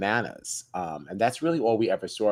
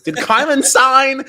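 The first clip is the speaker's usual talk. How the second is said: put-on high, loud, drawn-out drunk question; mock-urgent yell, stretched out; drunken yell; 12 dB louder, slower than usual. Drunk friend turns to John about the duck, yelling urgently.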